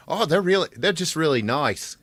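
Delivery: high, sweet mock Kiwi fan; New Zealander voice. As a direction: gush like a polite fan. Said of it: "The New Zealanders are like".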